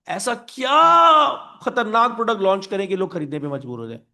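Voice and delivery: exasperated mock dilemma. Confused Apple wonders what to launch, exasperated. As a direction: wonder aloud in mock desperation.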